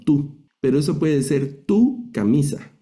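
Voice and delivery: tú said high and clear, contrasted with tu camisa; demo of tú. Shown as a demonstration. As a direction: point out the word tú by lifting it. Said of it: "tenemos la palabra tú".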